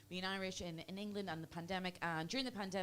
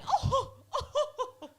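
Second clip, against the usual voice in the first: very high, hammed-up English lady, laughing; 9 dB louder than usual. English lady in a lift humouring the joke, high mock laughing voice. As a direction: shriek with polite laughter, building to a loud finish. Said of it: "And she said".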